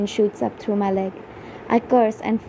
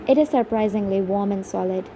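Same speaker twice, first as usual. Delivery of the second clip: ordinary delivery, nothing marked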